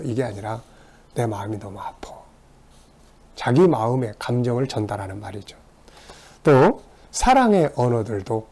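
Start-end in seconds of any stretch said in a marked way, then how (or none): 1.1-2.2 whispered, hurting quietly; soft hurt confession. Retold or imitated. I-message telling own hurt. (속삭이듯 아픈 마음을 털어놓으며)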